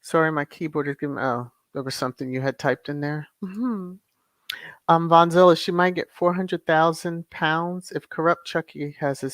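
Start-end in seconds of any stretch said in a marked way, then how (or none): 3.4-3.9 breathy whispered hum; whispered mm-hmm. Real, not acted. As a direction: whisper the agreement. Whispered agreement.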